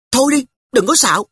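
put-on high, loud girlish scoff; a small girl waving off a silly claim; little sister scoffing. Little sister to big brother, high scoffing girl voice.

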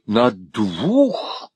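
long drawn-out «двух», rising at the end; exclaimed echo. A narrator's reading. Husband echoing his wife, drawn-out exclamation. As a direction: stretch «двух» into an astonished question. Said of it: "вскричал Монталини".

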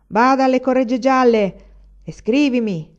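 loud high shout after him. A narrator's reading. Madman calling after the departing student, shouting. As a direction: call out across the distance. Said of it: "gridò il pazzo".

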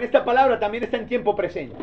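ordinary delivery

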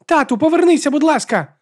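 very high, loud plea to Dad; pleading child. A narrator's reading. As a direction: beg your father to come back, voice shooting up. Син благає батька повернутися. (високе дитяче благання)

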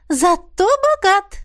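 slow, high, relishing zato bogat; mother agreeing. Acted. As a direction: savour «Зато богат» slowly. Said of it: "подтвердила мама". (мама смакует «Зато богат», высоко)

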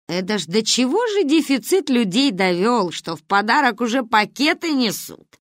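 lightly voiced mock-outraged grandma; brusque grandmother. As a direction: exclaim in amused indignation.

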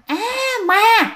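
high-pitched mock scream; imitated startled shriek; retold scream. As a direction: cry out as if seeing a ghost, but contained. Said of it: "tự nhiên bạn nghe tiếng ai hét lên".

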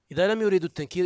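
normal talking voice

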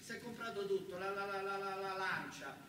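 ordinary talk, nothing marked

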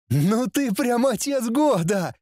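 high, drawn-out sarcastic jeer; mocking prince. A narrator's reading. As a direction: taunt your father in a high voice. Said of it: "заколыхался наследник". (издевательски, высоким тянущим голосом)

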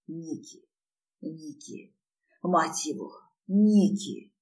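hushed, strained calls, rising on the last; a restrained narrated rasp; hoarse gasp of her name. A narrator's reading. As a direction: barely get her name out, then firmer. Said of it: "хрипел я".

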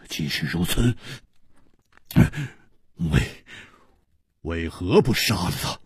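low, halting, dazed question. A narrator's reading. Stunned man asks the killer why he spares him, dazed. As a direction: stumble on the word, asking numbly. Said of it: "方子痴痴地说".

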